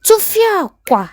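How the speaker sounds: high, slow outraged cry; shouted Tufia Kwa. Acted. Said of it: "Adora shouted".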